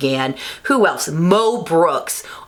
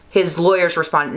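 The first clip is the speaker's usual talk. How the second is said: usual speaking voice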